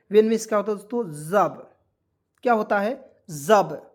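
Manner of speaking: ordinary talk, nothing marked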